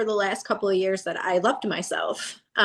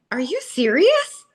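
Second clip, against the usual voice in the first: high, slow, stunned retelling; can't believe what she just heard; high and slow, almost breathless disbelief; 7 semitones higher, 4 dB louder, slower than usual. Retold or imitated. Retold disbelief at winning news.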